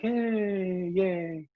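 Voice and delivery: a dutiful crowd clapping without joy; polite, flat cheer. Retold or imitated. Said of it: "It's respectful".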